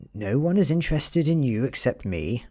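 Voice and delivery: a cornered man losing his composure, uneven and confused. Acted. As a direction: blurt it out, rattled and off balance. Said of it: "His voice comes out uneven and confused".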